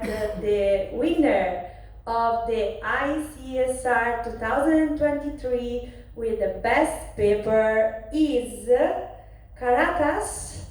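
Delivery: slow, drawn-out build with dramatic pauses before the name; suspenseful winner reveal. Real, not acted. Host announcing the best paper winner to the audience, slow suspenseful reveal. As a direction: hold the room, then land the winner's name.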